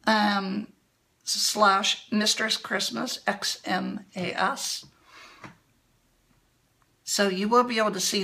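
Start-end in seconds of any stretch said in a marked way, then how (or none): none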